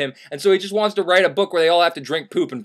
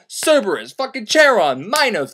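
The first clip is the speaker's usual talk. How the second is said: ordinary talk, nothing marked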